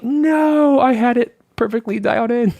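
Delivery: high, loud wail of dismay; mock anguished protest. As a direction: cry out in hammed-up despair at the kids. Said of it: "and I'm like".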